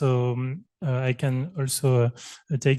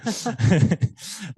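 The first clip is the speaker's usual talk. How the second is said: light laugh; 6 semitones higher than usual. Real, not acted. Joking about being from Rennes.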